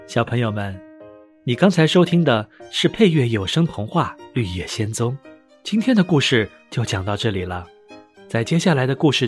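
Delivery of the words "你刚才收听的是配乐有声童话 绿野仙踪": plain reading voice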